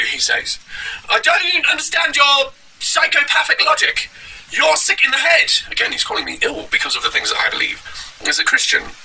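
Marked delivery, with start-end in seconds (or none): 1.1-5.5 slow, very high mock preacher shout. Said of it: "here it is from Cleveland Street Preachers".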